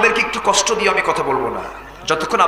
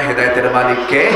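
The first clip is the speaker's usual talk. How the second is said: "হেদায়েতের মালিক কে?" a bit louder with a rise on কে; louder call to the crowd; 7 semitones lower than usual. Shown as a demonstration. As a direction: pull the answer out of the crowd. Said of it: "আস্তে বলবেন না".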